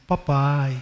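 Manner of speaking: put-on children sweet-talking their father; sweet coaxing. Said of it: "quando ele quer me agradar".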